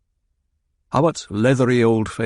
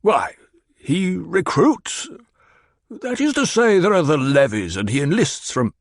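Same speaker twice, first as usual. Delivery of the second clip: put-on higher, whiny pouting-baby reply; petulant; 8 semitones higher than usual. A narrator's reading. The Baron answers Hawat like a pouting baby, petulant. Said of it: "his voice carried a tone of petulance".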